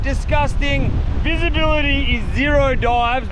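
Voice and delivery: ordinary talk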